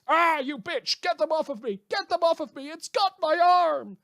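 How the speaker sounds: high, loud hammed-up screams of a victim being mauled; mock panicked screaming. Host playing a victim of Hellhound's dogs, hammed-up screaming. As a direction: shriek it like the dog has your arm.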